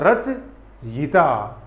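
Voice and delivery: रचयिता drawn out very slowly, every syllable clear; careful pronunciation. Shown as a demonstration. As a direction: stretch रचयिता out slowly as the correct form. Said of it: "क्या होता है?". (रचयिता बहुत धीरे, हर अक्षर साफ़)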